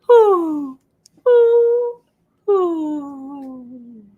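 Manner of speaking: high, hooting owl calls. Acted. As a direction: hoot like an owl. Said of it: "Who says".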